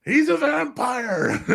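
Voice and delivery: high and slow, laughing through the outrage; laughing while mocking the author, high and slow. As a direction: giggle it out in a squeaky indignant voice. Keeps up the mock author voice.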